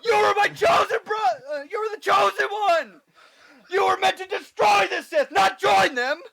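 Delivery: high, anguished shouting; Anakin on Mustafar. Acted. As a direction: yell it like a betrayed friend. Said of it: "playing Anakin on Mustafar".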